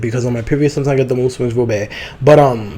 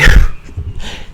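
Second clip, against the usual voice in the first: embarrassed breathy laugh. Real, not acted. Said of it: "That was bad".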